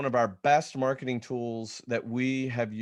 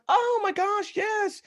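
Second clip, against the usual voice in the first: much higher, delighted squeal of recognition; sudden recognition; slower than usual. Retold or imitated. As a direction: play the moment it clicks. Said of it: "And then it went".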